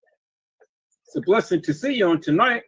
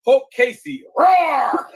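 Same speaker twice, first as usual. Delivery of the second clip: loud playful roar, higher pitch; mock Hulk roar; 6 semitones higher, 5 dB louder, much slower than usual. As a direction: roar it out like a cartoon monster. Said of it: "Hulk transformation roar".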